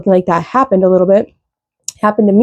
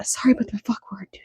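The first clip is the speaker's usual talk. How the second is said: whisper; 12 dB quieter, faster than usual. Real, not acted. Apologising aside for swearing.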